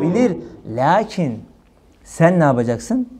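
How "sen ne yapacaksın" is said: ordinary delivery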